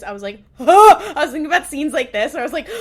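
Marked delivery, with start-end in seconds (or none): 0.6-1.1 high, loud re-enacted gasp. Viewer re-enacting a reaction to the scene, loud gasp.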